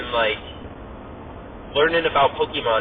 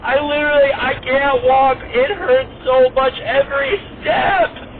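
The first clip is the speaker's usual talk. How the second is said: put-on high, loud, slow whiny child; whiny kid voice; 11 semitones higher, 5 dB louder, slower than usual. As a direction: whine it like a hurt little kid. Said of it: "he was like".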